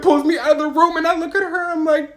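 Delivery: usual speaking voice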